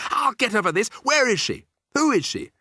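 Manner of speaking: high, loud demands; incensed high-pitched yelling; angry shouting. A narrator's reading. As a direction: fire off outraged questions at high pitch. Bruno rages about the witch.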